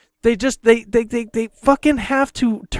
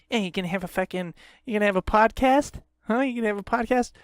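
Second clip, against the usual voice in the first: softer, needling mock question; mocking imitation; 6 dB quieter, faster than usual. Man mimics his girlfriend asking about podcast, soft and mocking. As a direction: imitate her prodding, under your breath.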